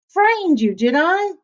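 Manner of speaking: little pig taunting the scared wolf; teasing little pig. A narrator's reading. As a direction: tease the wolf from safety. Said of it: "said the little pig".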